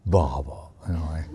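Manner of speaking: imitating an old guru's low, soft reply; low elder's reply. Disciple retelling the guru's reply, low soft imitation. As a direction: drop low and soft, like a grandfather chuckling. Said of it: "And he's like".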